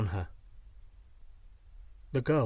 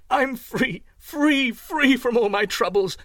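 loud, high-pitched jubilation; joyful cry; 9 semitones higher, 7 dB louder, slower than usual. A narrator's reading. Young man freed from his troubles, joyful shout. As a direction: exult at full voice. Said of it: "he cried, laughing joyfully".

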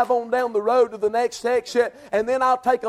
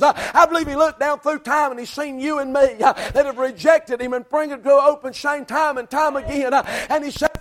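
full-force preaching; 4 semitones higher than usual. Acted. Preacher stirring the church about the cross, impassioned.

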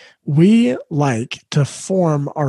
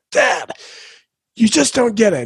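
higher, mock-frustrated teenage outburst; imitating upset teen; 6 semitones higher than usual. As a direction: play the son snapping back at dad. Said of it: "he'll just lay into me and start yelling".

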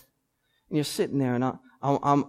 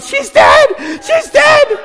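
much higher, much louder mock yelling; panicked shriek; 11 dB louder, much slower than usual. Daughter screaming over her sister's tick: hammed-up panicked shrieks. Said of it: "Talia's yelling out".

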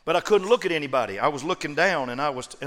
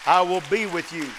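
I will be with you, louder, stress on I; repeated key phrase; 5 dB louder than usual. Shown as a demonstration. Preacher repeating God's promise to the congregation. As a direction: land I will be with you firmly on I. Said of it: "here is the key phrase".

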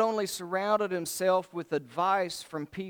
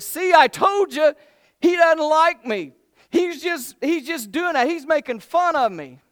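much higher, much louder petulant whine; hammed-up whiny king, high and loud; whining Ahab; 10 semitones higher, 11 dB louder than usual. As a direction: squeal it like a spoiled king tattling.

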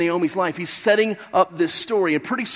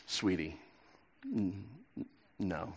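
much softer, gently brushing her off; quiet dismissal; 11 semitones lower, 18 dB quieter, slower than usual. Retold or imitated. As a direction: let her down quietly, almost under your breath. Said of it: "dismissed her quietly".

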